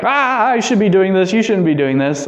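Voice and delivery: embarrassed. Retold or imitated. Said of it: "embarrassment on his part too".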